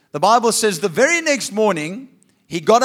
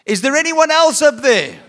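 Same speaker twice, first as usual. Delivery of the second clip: loud, higher shout up the cliff; calling out again; 6 semitones higher, 6 dB louder than usual. Acted. Man on the cliff calling up for someone else. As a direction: yell for a different rescuer.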